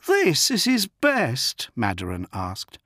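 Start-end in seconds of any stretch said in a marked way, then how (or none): 0.1-1.7 put-on high, slow disbelieving young woman; bitter high woman voice. Bitter refugee mother.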